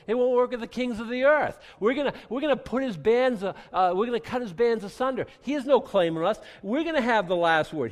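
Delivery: proud rebel kings boasting; defiant kings. Retold or imitated. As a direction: puff up like proud kings defying God. Defiant kings of the earth voiced.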